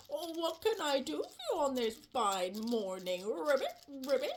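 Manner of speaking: low, genial frog puppet voice; friendly frog. Acted. Froggy greeting Rabbit on Easter morning, friendly frog. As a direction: play a cheerful low-voiced frog, ribbits flicking up.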